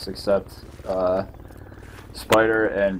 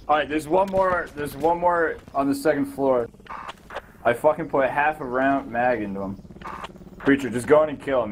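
ordinary delivery

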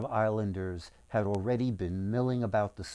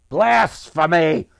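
loud, drawn-out outraged cry; slow, loud and indignant, with room to build; angry grumble; 5 semitones higher, 10 dB louder, slower than usual. A narrator's reading. Merchant condemns Lucas for sorcery, loud angry outburst. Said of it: "The merchant grumbled".

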